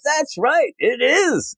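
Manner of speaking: high mock agreeable voice; goat lady. Goat owner agreeing with Clark, high mock chirp. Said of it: "And she goes".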